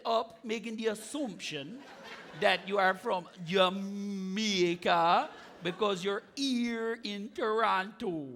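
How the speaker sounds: put-on Jamaican patois; Jamaican accent. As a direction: play a Jamaican sizing up a compatriot. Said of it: "You're an albino Jamaican".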